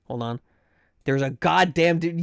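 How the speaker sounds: ordinary talk, nothing marked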